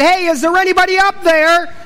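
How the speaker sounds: acted shout for help; calling for help. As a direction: call up to whoever might hear. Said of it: "he called out for help".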